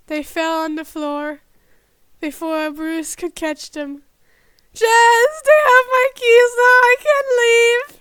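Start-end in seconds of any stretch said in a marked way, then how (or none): 4.7-7.9 high, loud desperate whine; panicked wail. Acted. Panicked man crying out.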